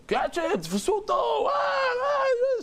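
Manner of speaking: high mock shouts rising into yelps; imitated screaming. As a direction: imitate a mob screaming in pursuit. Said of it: "the way they were screaming and running".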